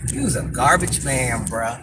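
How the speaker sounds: low, slow contempt; disgusted. Real, not acted. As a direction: shame them slowly in a low, disgusted voice. Disgusted verdict on the commenter.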